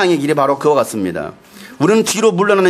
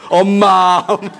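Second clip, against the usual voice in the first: drawn-out mock whine; child calling mom; slower than usual. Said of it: "저녁에는 아빠 안 찾아요". (엄마 찾는 아이 흉내)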